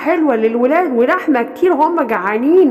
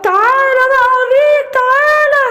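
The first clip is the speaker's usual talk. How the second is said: menacing roar; 10 semitones higher, 4 dB louder than usual. Acted. Bear attacks the lion.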